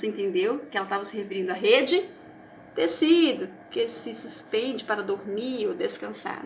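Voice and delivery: ordinary talk, nothing marked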